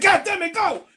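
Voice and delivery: high, loud mock yell; exasperated coach yell. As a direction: bark it like a fed-up coach.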